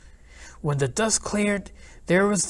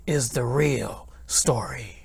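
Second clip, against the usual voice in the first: whispering; 5 semitones lower, slower than usual. A narrator's reading. Conspiratorial aside to reader.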